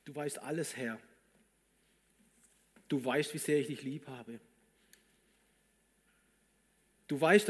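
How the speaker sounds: earnest prayer, swelling and falling back; heartfelt prayer. Acted. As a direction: pray aloud, confessing before God. Preacher prays aloud as Peter.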